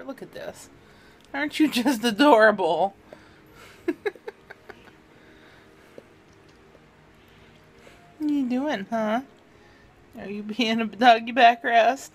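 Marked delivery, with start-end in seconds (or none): 1.3-12.1 cooing at dog. Real, not acted.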